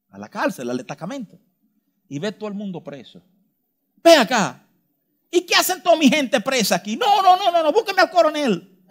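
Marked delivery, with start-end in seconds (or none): 4.0-8.9 mock outraged politician.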